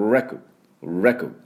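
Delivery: レコグ with strong R start and weak コ; English first syllables. Shown as a demonstration. Teacher drilling the first part, レコグ in English pronunciation. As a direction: lean on the start of レコグ, slip past the middle. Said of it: "この最初の部分ですね".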